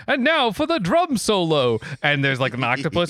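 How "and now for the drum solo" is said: high, loud cartoon crab announcer; hammed-up showman's introduction; Sebastian. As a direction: announce it grandly, drawn out, in a put-on character voice. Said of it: "Sebastian is like".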